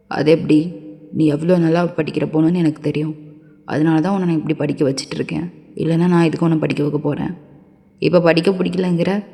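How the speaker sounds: low-voiced man scolding and pressing.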